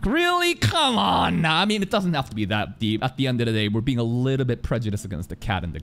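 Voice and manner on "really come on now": exasperated. Real, not acted. Exasperated plea repeated.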